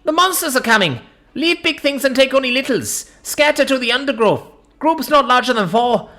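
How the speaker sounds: urgent shouted alarm, pitched high; shouted warning. A narrator's reading. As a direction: call out a warning to the whole group. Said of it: "I'm stood back up and shouting".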